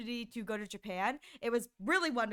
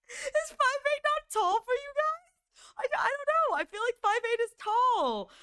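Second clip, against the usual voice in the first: incredulous; 10 semitones higher than usual. Real, not acted. Incredulous at chat.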